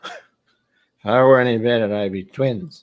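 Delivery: slow, slurred tipsy delivery; mock-drunk slow slur. Shown as a demonstration. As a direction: drawl it out like a drunk. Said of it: "sounds like a drunk statement".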